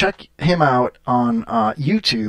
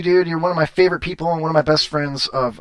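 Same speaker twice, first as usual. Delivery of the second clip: ordinary talk, nothing marked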